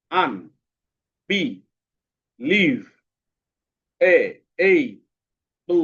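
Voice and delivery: "আন, বি, লিভ, এ, এই, বু" each alone with long pauses; one isolated beat at a time for "আন, বি, লিভ, এ, এই, বু"; split into syllables. Shown as a demonstration. Teacher making a long word easy, "আন, বি, লিভ" syllables spaced out. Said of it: "পাঁচটা সিলেবালে ভাগ করে".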